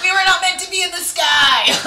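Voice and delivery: very high, loud mock-outraged shout; boyfriend's ranting shout. Boyfriend ranting against flying, shouted mock outrage. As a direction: shout it as an indignant decree. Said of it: "he's one of those".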